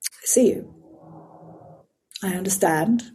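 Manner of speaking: soft, whispered I see you; tender reassurance to oneself; gentle reassurance. Retold or imitated. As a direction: calmly acknowledge the fear. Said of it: "then I go".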